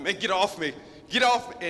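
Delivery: irritated brush-off. Retold or imitated. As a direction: shake him off, annoyed and rising. Buddy shaking his arm.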